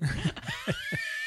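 high burst of laughter; loud laugh. Real, not acted. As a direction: shriek with laughter. Laughing at her own joke.

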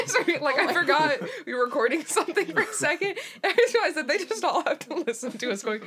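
giggling while talking; laughing. Real, not acted. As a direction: laugh through the words. Laughing at own realization.

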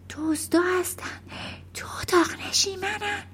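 high, tense whisper; hushed. A narrator's reading. Mother warning of thieves in the living room, whispering. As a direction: whisper it so the burglars cannot hear. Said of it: "مادر آهسته گفت".

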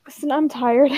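ordinary delivery, nothing marked

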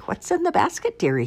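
high, wheedling wolf question; sly wolf. A narrator's reading. Wolf asking girl about her basket, slyly coaxing.

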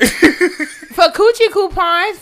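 loud, slow laugh-through. Real, not acted. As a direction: stretch it out while cracking up.

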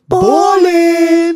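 high, loud, drawn-out hype yell; full-voiced imitation of a friend's singalong shout; imitating a yell. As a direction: yell it like a rap ad-lib. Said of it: "And he would yell".

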